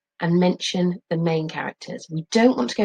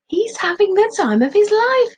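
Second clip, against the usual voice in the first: a much higher, louder happy dad; jolly dad; 6 dB louder than usual. Acted. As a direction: beam it out like a cheerful father. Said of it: "says Dad".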